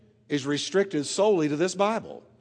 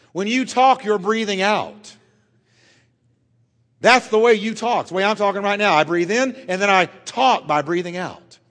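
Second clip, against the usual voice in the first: preacher stirring up the church; fervent preaching; 3 semitones higher, 4 dB louder than usual. Real, not acted. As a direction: press the point home with fire. Preaching at full force.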